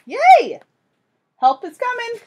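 high, loud excited cheer. Acted. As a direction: celebrate like a kid. Teacher cheering help arrives.

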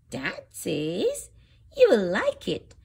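usual speaking voice

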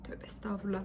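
breathy embarrassed whisper. Real, not acted. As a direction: whisper a quick repentance. Embarrassed pious muttering. (fısıltıyla tövbe)

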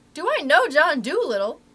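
loud, high, emphatic retort; boisterous mock disbelief; emphatic incredulous reply. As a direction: echo the question as if it's absurd to ask. Acted amazed reply to boy's question.

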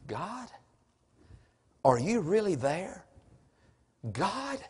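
hushed doubting prayer. Acted. Preacher voicing Habakkuk questioning God, hushed and doubtful. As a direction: softly call out to God, with pauses.

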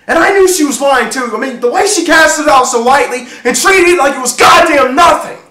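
loud, high-pitched shouted tirade; furious rant. Acted. Jealous husband accusing his wife of lying, furious shouting. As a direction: rage at full volume, barely stopping.

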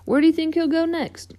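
high, exasperated but eager; folksy frustration, still keen on the chase; excited tow truck character. Acted. Voices Mater in story.

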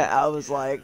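ordinary talk, nothing marked

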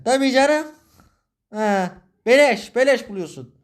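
very high, loud mocking "oh sure", slow on "beleş"; sarcastic scoff. Scoffing at being told to pay the debt himself, high and sarcastic.

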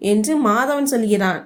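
ordinary delivery

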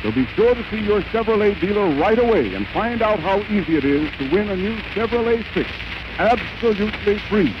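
usual speaking voice

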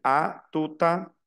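"A tout à" slowly, as "a... tuta"; slow French model of "A tout à", joined; linked words. Shown as a demonstration. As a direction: link the T of "tout" onto "à". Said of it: "entonces esto se une".

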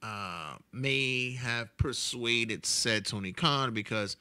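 normal talking voice

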